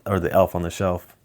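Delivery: ordinary delivery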